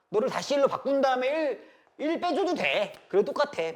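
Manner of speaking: loud, high-pitched excited teaching; emphatic explaining. Real, not acted. Teacher showing students a substitute they can use, emphatic explaining. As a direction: raise pitch and volume to drive the shortcut home. Teacher exclaims the shortcut works.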